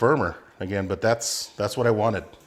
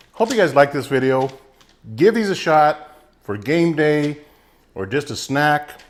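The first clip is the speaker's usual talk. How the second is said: mouth full; 5 semitones higher, 7 dB louder than usual. Real, not acted. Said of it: "Sorry, I'm talking with my mouth full".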